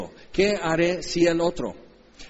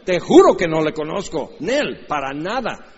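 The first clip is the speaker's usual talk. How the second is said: nervous laughing denial, louder; Peter denying; 3 semitones higher, 4 dB louder than usual. Retold or imitated. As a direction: deny it with a jittery laugh. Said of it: "Tú lo conoces, ¿verdad?".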